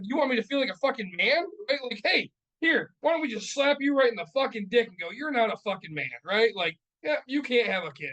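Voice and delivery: high, heated sarcastic retort; bitterly mocking comeback; wounded indignation. Retold angry retort about manhood.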